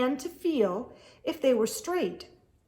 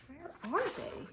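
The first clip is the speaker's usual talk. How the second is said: puzzled whisper; 5 semitones higher, 12 dB quieter than usual. Acted. Reader wonders at missing caps.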